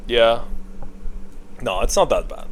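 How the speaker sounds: usual speaking voice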